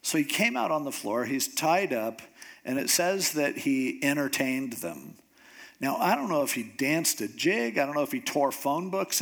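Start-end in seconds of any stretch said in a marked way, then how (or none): none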